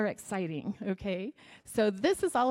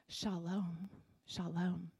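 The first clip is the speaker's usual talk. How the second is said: soft shalom; 3 semitones lower, 9 dB quieter, much slower than usual. Shown as a demonstration. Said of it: "say it in Hebrew".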